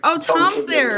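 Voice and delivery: high excited squeal; thrilled to spot him. Real, not acted. Excited spotting.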